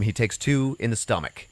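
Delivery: usual speaking voice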